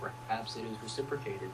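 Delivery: lightly voiced dignified suitor; mock formal reply. Replying to her crush post, mock formal. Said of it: "I replied, and I was like".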